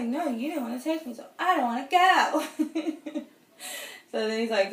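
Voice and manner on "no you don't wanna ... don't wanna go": high, loud, slowing bratty pout; bratty whine. Retold or imitated. Pouting woman refusing her boyfriend, high bratty whine. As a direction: whine it like a spoiled kid, half laughing. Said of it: "I am turning into a brat".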